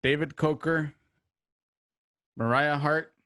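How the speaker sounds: ordinary talk, nothing marked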